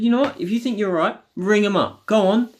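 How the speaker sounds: challenging dad. Retold or imitated. Arguing with dad, retold.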